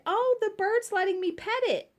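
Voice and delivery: put-on high, bright little-kid voice; mock little girl proudly reporting; child's happy voice. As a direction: play your younger self, delighted. Child voice quoted in story.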